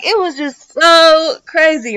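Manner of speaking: normal talking voice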